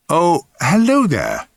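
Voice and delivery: high, slow, swooping hello; bluffed recognition. A narrator's reading. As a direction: echo her greeting as if you know her. Bluffing reply to unknown woman.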